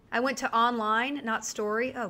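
ordinary talk

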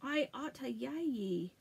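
slow, mushy words with a stiff lip; mumbled protest through a hurt lip; talking without upper lip. Acted. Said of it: "without using her upper lip".

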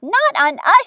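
high, loud, amused grandma; amused exclaiming. A narrator's reading. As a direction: exclaim it as if tickled, without a real laugh. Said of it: "Grandma laughed".

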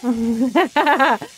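loud burst of laughter; laughing. Real, not acted. Player cracks up during the game, laughing. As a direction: laugh out loud in a quick run.

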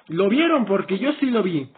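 usual speaking voice

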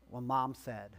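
low, sighing reluctance; imagined Jesus, sighing and reluctant; resigned sigh. Pretend. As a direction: give in with a weary sigh. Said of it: "I picture Jesus doing this with a sigh".

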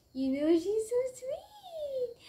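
high, slow cooing. Real, not acted. Vlogger praising her jumpy bunny, high baby-talk coo. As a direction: melt over the bunny, sing-song.

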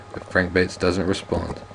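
usual speaking voice

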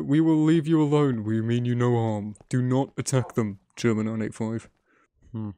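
ordinary delivery

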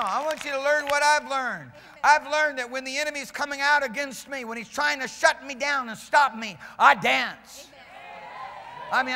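loud, high, fired-up sermon delivery; full-force preaching. Real, not acted. Preacher testifying that he dances against the enemy, fired-up preaching. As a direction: testify with rousing, insistent force.

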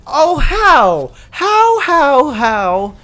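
high, loud cry of disbelief; disbelieving yell. Real, not acted. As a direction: shout it out, baffled. Baffled she survived.

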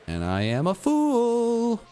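high, slow comic wail; self-mocking. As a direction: wail the punchline at yourself.

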